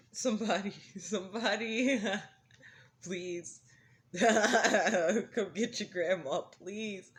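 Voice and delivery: loud, slow plea broken by laughter; laughing plea. Real, not acted. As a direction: beg through your laughter. Laughing plea to come get grandma.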